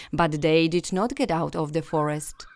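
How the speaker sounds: ordinary delivery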